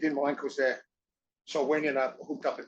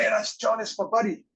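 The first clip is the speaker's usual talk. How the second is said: higher, eager bragging; excited boast; 8 semitones higher, 3 dB louder than usual. Retold or imitated. Jericho telling Canada about his buddy, pitched up and eager. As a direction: show off your famous buddy, pitched up. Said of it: "told everybody".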